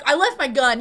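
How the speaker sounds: offhand, tossed-off mimicry; mock casual. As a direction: say it like it's nothing. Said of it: "'Cause you're like".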